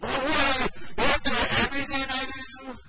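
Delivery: mock teenage outrage, fast; hammed-up offended teenager; defensive teen. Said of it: "It's like".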